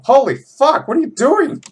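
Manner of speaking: loud, high mock outrage; shocked shout. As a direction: yell in disbelief at the screen. Shocked at too much oil.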